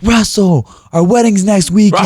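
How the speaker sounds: high, loud mock wail; hammed-up high female outcry; imitated dismayed fiancée. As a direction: wail it like an upset bride-to-be. Retold dismayed fiancée crying out.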